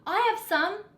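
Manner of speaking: eager shout with a lift on "some"; excited shout. A narrator's reading. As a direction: villager eagerly volunteering, rising tune. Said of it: "people cried out".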